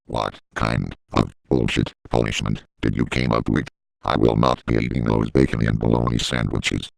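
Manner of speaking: sagging robot voice cursing low; deep slowed voice. Pretend. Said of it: "said my obscenity line in a slower pace".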